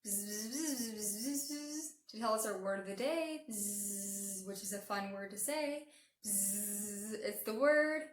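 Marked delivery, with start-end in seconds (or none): 0.0-1.9 rhythmic bee buzz, rising and falling; playful zigzagging bzz-bzz; buzzing bumblebee. Pretend. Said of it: "And the other ear too". 3.5-4.5 one long soft bee buzz; quiet drawn-out bee drone; buzzing bumblebee. Pretend. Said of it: "come and buzz in your ear". 6.2-7.1 one long steady bee buzz; drawn-out bee drone; buzzing bumblebee. Pretend. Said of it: "come and buzz in your ear".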